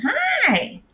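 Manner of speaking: mock his pained cry; imitating a yell. Retelling her husband's pained morning yell, a sharp yelp. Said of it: "he was just grabbing it and yelling".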